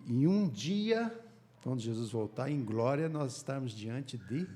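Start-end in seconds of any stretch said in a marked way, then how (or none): none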